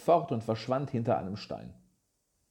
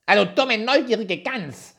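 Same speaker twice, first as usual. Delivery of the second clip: high, loud, slowed scornful insult; nasty dwarf; 10 semitones higher, 7 dB louder than usual. A narrator's reading. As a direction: spit the insult down at the girl. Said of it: "antwortete der Zwerg".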